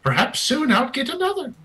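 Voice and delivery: high-pitched taunting voice from behind; high little mystery voice; eerie unseen voice. Acted. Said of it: "you hear from somewhere behind you".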